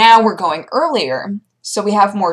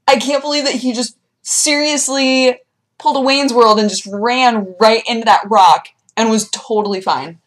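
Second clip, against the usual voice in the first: ordinary delivery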